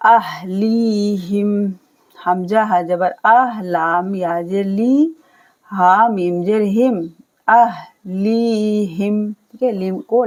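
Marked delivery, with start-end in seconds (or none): 0.1-1.7 अहलीहिम with the ली held long; slow recitation; drawn-out li. Shown as a demonstration. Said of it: "लाम को क्या करना है, खींचना है". 7.5-9.3 अहलीहिम with the ली held long; slow recitation; drawn-out li. Shown as a demonstration. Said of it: "लाम को क्या करना है, खींचना है".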